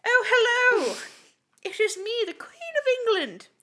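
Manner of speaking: high, loud posh British queen voice; Queen of England. Acted. Host playing the Queen answering a podcast call. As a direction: put on a grand royal British accent. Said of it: "dial into the queen now".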